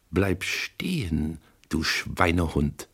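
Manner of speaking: slow, quiet threat through the teeth. A narrator's reading. Woman with a pistol warns the narrator, slow hushed menace. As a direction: hold her at gunpoint, soft and deadly.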